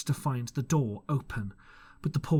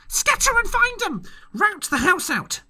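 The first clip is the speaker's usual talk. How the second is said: very high, loud screaming order; yelled order; 6 dB louder than usual. A narrator's reading. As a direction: scream the command at them. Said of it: "reiterated Pew".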